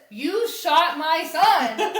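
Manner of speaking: hammed-up slow, loud accusation; mock accusation. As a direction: imitate her outrage, drawing out each word. Acted accusation in retold quarrel.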